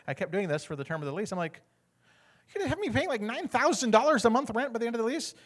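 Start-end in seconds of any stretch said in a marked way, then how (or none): 2.5-5.4 incredulous. Retold or imitated. Tenant shocked at lease total, high incredulous squeak. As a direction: pitch jumps way up in mock disbelief.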